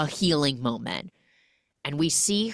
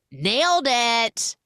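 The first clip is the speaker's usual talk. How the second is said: proud cheer; 8 semitones higher, 5 dB louder, slower than usual. Retold or imitated. Proud after earning five pounds.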